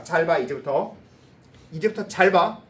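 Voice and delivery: loud, forceful teacher voice; emphatic call for attention. Real, not acted. Teacher telling students to watch from now on, loud and emphatic. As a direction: raise the volume and command their attention.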